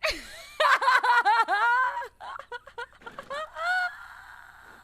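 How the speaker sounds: high, loud, long laughter; loud laughing fit. Real, not acted. Player loses it over a failed accent, loud high laughing fit. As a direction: lose it in a squealing laugh.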